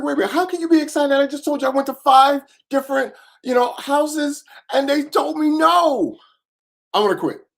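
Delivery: high, loud, pleading protest; a thirteen-year-old protesting in disbelief; incredulous outburst. Retold or imitated. Teen protesting to his mom, high and loud.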